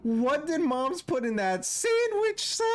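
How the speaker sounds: real stunned amazement with a laugh; shocked. Real, not acted. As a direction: gasp it out in disbelief. Shocked by creature transforming.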